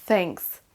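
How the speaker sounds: "Thanks" with tongue at the teeth for TH; English TH. Shown as a demonstration. Teacher models for learners, "Thanks" opened on a true TH. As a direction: model "Thanks" with a careful TH, falling. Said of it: "le même son T H avec la langue au niveau des dents".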